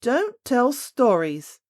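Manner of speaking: loud, slow, rude snap from the nurse; rude cry. A narrator's reading. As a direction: bite off each word, loud and rude. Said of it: "cried her nurse quite rudely".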